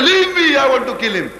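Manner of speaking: "leave me" pitched very high, then falling; acting held back. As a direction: bluster as though someone is restraining you. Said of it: "As if somebody is holding to him".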